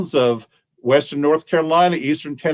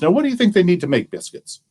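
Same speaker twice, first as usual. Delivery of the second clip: usual speaking voice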